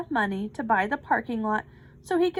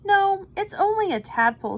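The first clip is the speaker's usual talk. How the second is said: protesting; 8 semitones higher, 5 dB louder than usual. A narrator's reading. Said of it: "said Louis".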